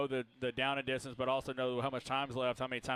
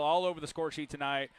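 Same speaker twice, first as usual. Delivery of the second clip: ordinary talk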